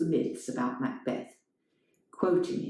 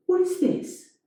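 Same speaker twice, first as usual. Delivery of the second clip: high, shocked question; higher, shocked; alarmed; 4 dB louder than usual. A narrator's reading. Elena alarmed.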